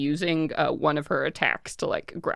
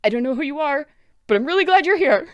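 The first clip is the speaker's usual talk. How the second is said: put-on high, rushed relieved girl voice; frightened girl; 10 semitones higher, 7 dB louder, much faster than usual. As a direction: blurt it out, relieved and scared. Gwen voiced in the story.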